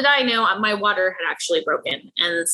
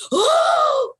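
group gasp; 3 dB louder than usual. Retold or imitated. Whole room reacts to jab.

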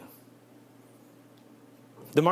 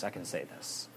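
softer, lower murmured resolve; quiet resolve; 7 semitones lower, 12 dB quieter than usual. Shown as a demonstration. Preacher voicing a fearful listener, soft resolve. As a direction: say it under your breath. Modelling brave self-talk.

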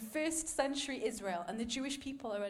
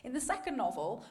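normal talking voice

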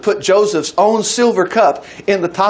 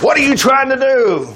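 demanding; 7 semitones higher than usual. A narrator's reading. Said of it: "he demanded".

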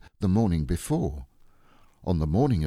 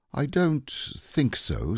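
slow, higher, deliberating; grave thought; 9 semitones higher, slower than usual. A narrator's reading. Owl weighing a rescue, slow and doubtful. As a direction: answer slowly after solemn thought. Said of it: "after grave thought".

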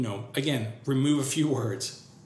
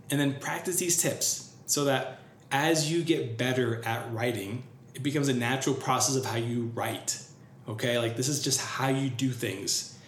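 ordinary delivery

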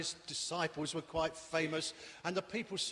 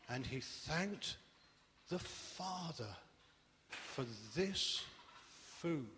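reverent prayer; 2 semitones lower, 6 dB quieter, slower than usual. A narrator's reading. Jesus praying thanks reverently.

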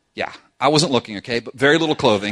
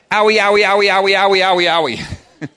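put-on higher, louder child's wail of owie; child crying owie; 5 semitones higher, 6 dB louder, faster than usual. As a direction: whine like a spanked little kid. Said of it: "as one of my children used to say".

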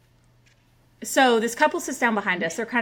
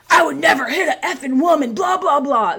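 loud, higher mock drunken bluster; belligerent drunk; 6 semitones higher, 6 dB louder than usual. Woman imitating the drunk fan's denial, loud and belligerent. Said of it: "he's belligerently drunk at this point".

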